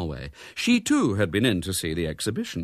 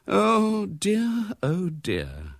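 weary, drawn-out dismay; groaning dismay; 10 semitones higher, much slower than usual. A narrator's reading. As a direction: groan with sinking realisation. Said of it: "groaned Missus Brown".

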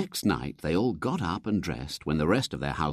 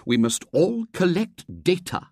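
higher, chirpy committee president; brightly; 11 semitones higher, 4 dB louder than usual. Acted. President addressing the society, bright and chirpy. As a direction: announce the task cheerfully. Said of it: "said the president brightly".

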